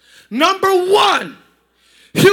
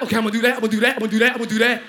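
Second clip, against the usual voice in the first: lower, chanting I'm gonna do that like a movie brawler; mock-hype yourself up, lower and laughing; lower mock fighter; 7 semitones lower, much faster than usual. Preacher voicing a churchgoer hyped on Bruce Lee movies, lower mock fighter.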